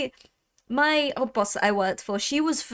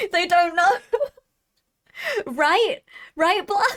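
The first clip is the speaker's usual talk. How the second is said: laughing; 10 semitones higher, 5 dB louder than usual. Real, not acted. Laughing at own accent story.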